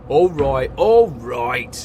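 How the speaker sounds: loud, grudging growl. A narrator's reading. Engine shouted at by his controller, loud grudging growl. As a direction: give in with a loud, annoyed growl. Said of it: "he growled".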